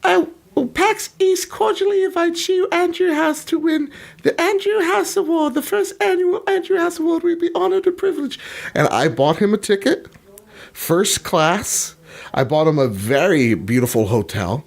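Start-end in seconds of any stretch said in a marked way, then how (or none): 0.0-8.4 very high mock posh announcement, reading the invitation; Andrew House. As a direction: ham up a prim, high official invitation. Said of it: "he's just like".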